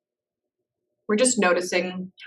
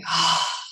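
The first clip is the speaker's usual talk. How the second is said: audible sigh. Shown as a demonstration. Guide demonstrating the letting-go breath, audible releasing sigh. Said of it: "release out with an audible sigh".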